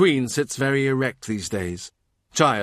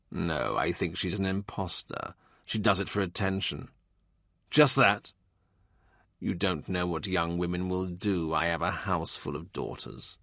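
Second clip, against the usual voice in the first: put-on a weary old father's quiet contempt; stern old man; 5 semitones lower, 7 dB quieter than usual. More scorns the prophetess.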